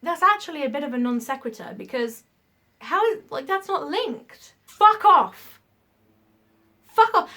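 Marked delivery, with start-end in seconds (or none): 4.6-7.3 loud, high furious swearing; angry outburst. Real, not acted. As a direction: snap it out in fury. Outburst at MLM reps.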